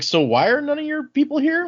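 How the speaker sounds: very high, baffled voice; a weak prisoner asking where everyone went; puzzled question. Retold or imitated. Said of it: "Raiden's like".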